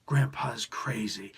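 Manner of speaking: slow, breathy whispered quote; hushed whisper. Retold or imitated. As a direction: whisper it like a dark secret. Quoting Jonathan's line again.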